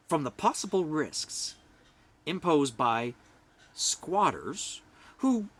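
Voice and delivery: usual speaking voice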